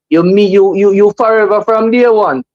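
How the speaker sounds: ordinary delivery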